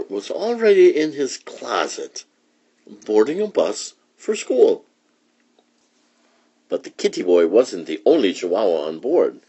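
plain reading voice

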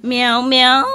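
two imitated meows, loud and laughing; cat meows. Shown as a demonstration. Man telling a stray cat to come eat, meowing. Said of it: "Come eat".

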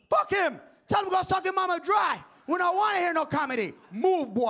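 put-on high, loud Jamaican-accented jeers; hostile heckling. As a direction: imitate an angry Jamaican crowd shouting down a comic. Hostile Jamaican crowd booing him.

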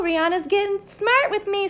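ordinary delivery

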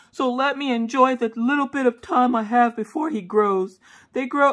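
high, whiny husband voice; imitated pleading. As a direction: plead like a doting puppy owner. Husband pleads to hold puppy.

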